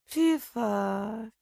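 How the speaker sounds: lightly squeaky rat comfort; soothing squeak of a rat. A narrator's reading. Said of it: "утешала её Ратита".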